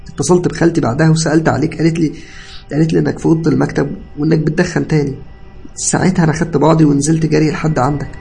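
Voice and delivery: high, loud, agitated account; tearful explanation. A narrator's reading. Cousin recounting the collapse to patient, distressed. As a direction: explain urgently, still upset. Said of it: "اتكلم ابن خالتي وهو بيعيط".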